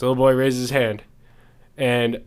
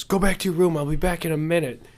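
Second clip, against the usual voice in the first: a hint of a higher stern father; lightly voiced dad shooing his son away; dad sending the son back to his room; 5 semitones higher than usual.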